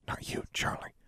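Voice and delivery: soft breathy whisper. A narrator's reading. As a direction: whisper it under your breath. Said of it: "Alexandra whispered".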